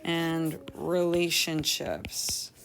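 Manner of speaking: very slow, drawn-out words with long pauses; composing aloud while writing. Shown as a demonstration. Teacher drafting a story's lesson in a journal, slow and halting. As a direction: feel out each word as you write it.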